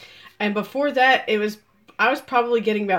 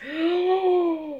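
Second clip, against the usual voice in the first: long, high mock gasp; shocked gasp; 6 semitones higher, 4 dB quieter than usual. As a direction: suck in air in exaggerated shock. Acts out shocked reaction.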